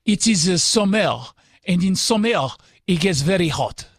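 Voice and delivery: put-on a quick cheesy French accent for a laugh; half-hearted French accent. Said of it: "Only if you do it in a French accent".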